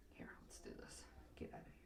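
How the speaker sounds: soft whispered self-instruction; hushed aside while concentrating. Real, not acted. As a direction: whisper it to yourself. Aside while working on hair.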